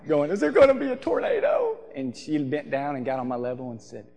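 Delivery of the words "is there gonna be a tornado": put-on very high wailing kid voice; mock crying. Twelve-year-old running to mom in a storm, mock crying. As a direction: squeal it like a scared, crying child. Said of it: "I ran to my mom crying".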